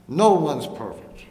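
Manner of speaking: preaching. Acted. Preacher answers his own question.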